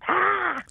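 sudden high cackling "Ha!"; imitated cackle. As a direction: mimic a co-anchor's short, high cackle. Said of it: "did this cackle".

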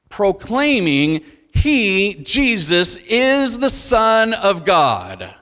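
slow, weighty stress on each word of is the Son of God; emphatic proclamation. Acted. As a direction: proclaim it slowly like a preacher driving the point home. Preacher proclaiming the key message.

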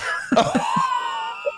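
shrieking laugh at her own punchline; high burst of laughter. Real, not acted. Big laugh after punchline.

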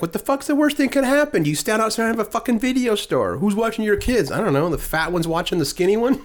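high, exasperated mom impression; mother in the movie cursing about the kids, in a high voice. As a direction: squeal it like the fed-up movie mom. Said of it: "And she's like".

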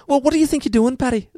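high-pitched cartoon Irish leprechaun; Irish accent gone all leprechaun. Shown as a demonstration. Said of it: "I go all leprechaun".

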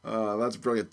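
normal talking voice

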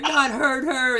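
high, loud trailer climax; hammed-up, punched-out trailer threat; mock trailer line. Friend mocking a movie trailer's edit, mock-dramatic.

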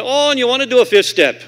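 loud, high mock exclamation; priest exclaiming. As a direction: boom it out, imitating the priest on the phone. Acts out priest on the phone.

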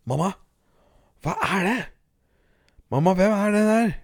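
put-on high, frightened little boy's voice; a small boy calling out to his mother, rising high; child voice pleading with mamma; scared child asking. A narrator's reading. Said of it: "undret Sverre Albert på".

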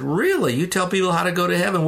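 genuinely taken aback; surprised dad. Retold or imitated.

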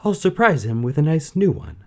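lightly voiced eager princess. A narrator's reading. Princess continues.

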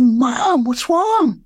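put-on high, worried little boy asking; a small boy's alarmed question to his mother; child's plea.

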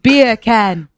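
Beer can in British accent, beer as /piə/ without r. Shown as a demonstration. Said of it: "with a British accent".

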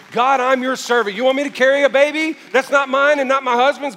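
Mary's pleading prayer, preached loud; fervent prayer. Acted. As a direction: plead it out before God.

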